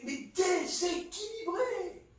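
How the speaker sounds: ordinary delivery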